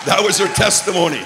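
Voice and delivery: loud and high declaration; proclaiming shout. Real, not acted. Preacher shouting the punchline to the congregation. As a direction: proclaim it to the whole church.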